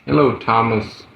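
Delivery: ordinary delivery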